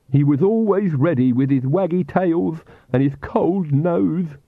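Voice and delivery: high, slow, tearful servant voice; a lightly voiced Igor sobbing over his dog. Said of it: "Igor sobbed".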